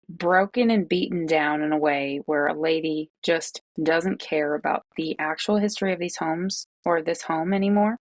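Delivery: normal talking voice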